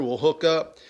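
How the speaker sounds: ordinary delivery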